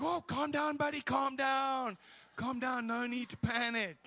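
loud, high, stretched-out reassurance; hammed-up shout to settle a panicker; urgent mock calming. Dad to the flustered ticket seller, loud mock calming.

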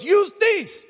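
high, loud yelled order; shout. Retold or imitated. Driver yells while tossing the cameras, shout. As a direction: bark it out of the car window. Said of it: "he goes".

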